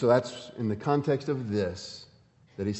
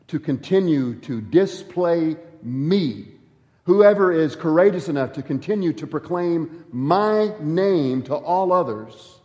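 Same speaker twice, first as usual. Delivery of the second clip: higher and louder, slow and deliberate; stretched-out pulpit emphasis; fervent preaching; 4 semitones higher, 5 dB louder than usual. Acted. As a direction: press each word home. Preaching about courage.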